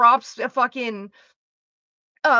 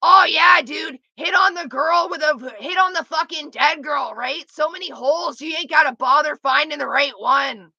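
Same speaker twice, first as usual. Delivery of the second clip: loud, higher shouting ghoul voice; haunted-house corpse voice; 3 semitones higher, 4 dB louder than usual. Shown as a demonstration. The corpse actor answering guests who hit on her, in a shouted ghoul voice. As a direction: yell it like a rotting corpse scaring guests. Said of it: "I'll do it in the voice".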